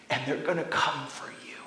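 whispered warning; Jesus warning the disciples in a whisper; ominous whisper. Acted. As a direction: drop to a whisper, full of dread. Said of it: "warning dropped to whisper".

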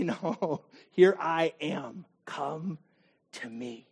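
soft, slow and low tender reply; quiet tender welcome; gentle invitation. Pretend. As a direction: answer like a loving friend. Jesus answering gently.

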